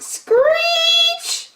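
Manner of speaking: a piercing high screech like a bad trumpet. Reading to a child, makes the trumpet screech. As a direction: squeal it out shrill and high, like a trumpet.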